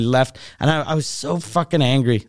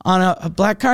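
ordinary talk

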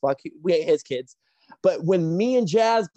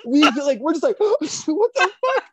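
high-pitched laughing disbelief; corpsing; 10 semitones higher, 5 dB louder than usual. Retold or imitated. Wrestlers breaking character in the ring, helpless laughter. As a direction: gasp and crack up mid-line. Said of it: "corpsing like a motherfucker".

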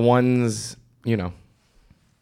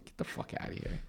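mock whisper; 21 dB quieter than usual. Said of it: "I'm like".

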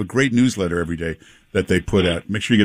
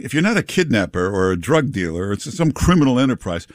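normal talking voice

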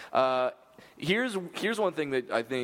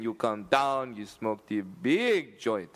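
slurred, slow mock Jamaican; drunk Jamaican accent; slower than usual. Host taking a listener request, drunk Jamaican accent. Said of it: "slur the speed like Jamaican".

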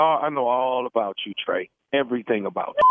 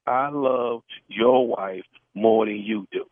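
slow repeat; 2 semitones lower, much slower than usual. Acted. Prank caller repeats confession slowly.

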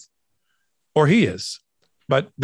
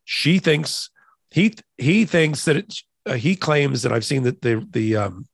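ordinary talk, nothing marked

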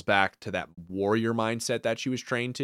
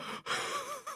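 high laugh; 18 dB quieter than usual. Real, not acted. Laughing at the scene.

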